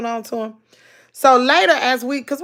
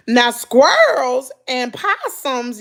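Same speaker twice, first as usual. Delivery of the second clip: ordinary delivery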